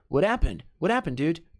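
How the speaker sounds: "What happened" run together with no H; American linking, H dropped. Shown as a demonstration. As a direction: link "What" straight into "happened", H silent. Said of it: "dica de pronúncia americana". ("What happened" emendado, sem som de H)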